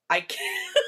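high, squeamish laughing refusal; laughing protest. Real, not acted. Host recoiling from co-host's meat preference, laughing protest. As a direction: protest through real laughter, voice shooting up.

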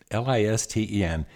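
"L I S T E N" spelled letter by letter; "L I S T E N" as separate letters. Shown as a demonstration. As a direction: spell out "L I S T E N" one letter at a time. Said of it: "Just listen".

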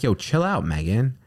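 mock telling off. Retelling telling partner off.

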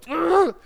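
high, loud strangled "ugh"; exasperated growl. Retold or imitated. Driver hung up on, letting out an exasperated growl. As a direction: let out a re-enacted groan of frustration. Acts out exasperation while driving.

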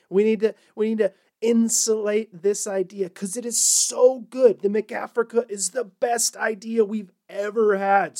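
imitating a thrilled executive; mock excited pitch. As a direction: oversell it with loud, punched superlatives. Acting out overexcited McDonald's marketers.

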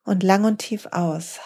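slow, calm breathing instructions with long pauses; soothing yoga teacher counting breaths; guided breathing. Acted. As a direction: guide the listener through each breath, unhurried. Leads a breathing exercise.

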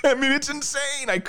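high, laughing through the words; giggling at how crazy it is. Real, not acted. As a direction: laugh it out in disbelief. Laughing at the story.

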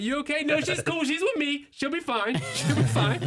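high, hurried cover-up reassurance; laughing mock calm; producer and flustered dad. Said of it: "The producer's like".

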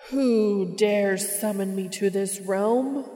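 slow, loud, commanding boom from above. Acted. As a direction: boom it out like an all-powerful being. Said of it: "A voice booms from somewhere overhead".

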